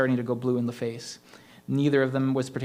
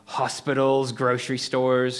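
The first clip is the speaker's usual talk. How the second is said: slow gleeful echo, savoring each word; maniacal glee; 5 dB louder, slower than usual. A narrator's reading. Ghost echoing Cole's list with maniacal glee. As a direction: relish the disaster. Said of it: "echoed with maniacal glee".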